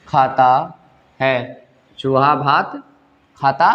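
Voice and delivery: word-by-word classroom dictation. Shown as a demonstration.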